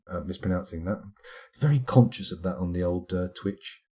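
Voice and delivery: ordinary talk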